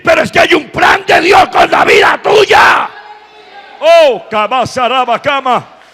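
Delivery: high, very loud fervent sermon; preaching at full force. Real, not acted. Preacher telling congregation of God's plan, full-force preaching. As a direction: build to an ecstatic shouted peak.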